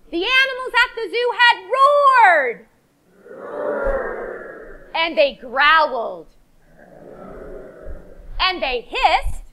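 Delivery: lively, high storybook voice for little ones; reading to children. Acted. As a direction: tell it big and bright for toddlers.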